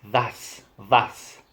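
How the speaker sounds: "that's" ending in an airy t; Irish final T. Shown as a demonstration. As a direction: let the t of "that's" slide towards s. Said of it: "They say".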